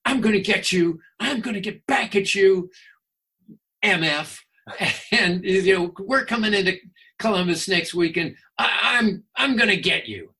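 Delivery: mock-angry threat, hammed up; livid threat. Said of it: "Their trainer was just livid".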